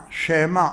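שמע in two syllables, SHE-MA; voiced sheva. Shown as a demonstration. Rabbi demonstrating the rule to students, clear SHE-MA. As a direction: give the shin in שמע its own E vowel. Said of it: "אומר שבה נע".

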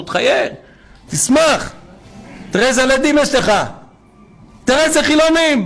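high, rising appeal to a father; impassioned plea. Retold or imitated. Preacher telling Father in heaven to rejoice in His children, impassioned plea. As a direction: plead excitedly, pitch climbing well above normal.

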